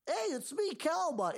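very high cartoon creature voice; chirpy little cartoon pal saying hi; Calmon voice. Co-host mimicking Calmon's greeting, high and chirpy. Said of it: "Calmon's out here just like".

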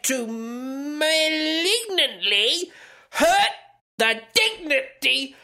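high, slow, hammed-up state propaganda voice; mock North Korean newspaper. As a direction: declaim it high-pitched and slow like a pompous decree. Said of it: "the North Korean newspaper went on to say".

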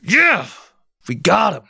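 high, gleeful yelp of victory; giddy victory cry; triumphant cheer. Acted. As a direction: crow like you just caught him. Triumphant cheer before a fake announcement.

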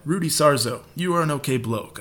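normal talking voice

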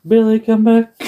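high, pleading call for Billy; mock plea. Friend begging his old tag partner to return, high mock plea. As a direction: call out longingly for him. Repeated plea, much higher.

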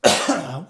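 sudden cough fit; hacking cough mid-talk; choking cough. Real, not acted. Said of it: "I'm getting excited".

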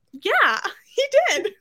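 high, laughing mock admission; giggly high-pitched yes; sheepish friend. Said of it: "And Molly May's like".

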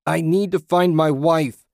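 loud, high desperate shout; screaming. A narrator's reading. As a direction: shout frantically at an unseen voice. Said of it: "he screamed".

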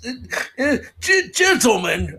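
straining in mock horror to force out gentlemen; a pretend struggle to say the word, ending on gentlemen; mock dread. Commentator forces himself to say gentlemen, hammed-up terror. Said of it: "I can't even say this last word".

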